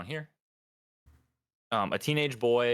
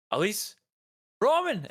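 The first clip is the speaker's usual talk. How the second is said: high-pitched cry, growing louder; frantic calling; 12 semitones higher, 5 dB louder than usual. Acted. Game master as frantic guard calling for family, alarmed cry. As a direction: call out names in alarm.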